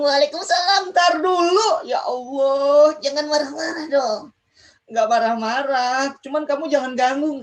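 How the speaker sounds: the impatient puppet answering back; pesky puppet friend. Acted. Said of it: "diganggu terus nih sama teman Kak Ojan".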